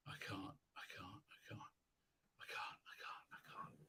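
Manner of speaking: barely audible, voiceless whisper; despairing whisper. Acted. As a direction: whisper it over and over, defeated. Despairing at what they must watch.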